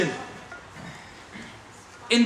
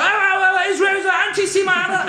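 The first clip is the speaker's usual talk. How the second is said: mock crowd shouting; 8 semitones higher than usual. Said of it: "everybody says".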